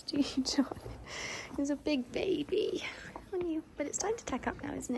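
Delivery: high cooing voice to a horse; pet talk. Real, not acted. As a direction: coo softly to a sleepy pony. Talking to her horse.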